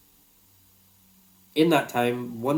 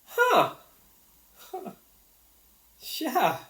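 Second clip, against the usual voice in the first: stunned realisation; 4 dB louder, much slower than usual. Retold or imitated. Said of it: "was just like a".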